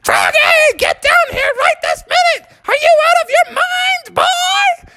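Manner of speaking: frantic, very high falsetto scolding; scolding mom. Acted. Mom orders her child down, high angry shriek. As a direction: screech it as an outraged cartoon mother. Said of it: "And Froggy's mom was like".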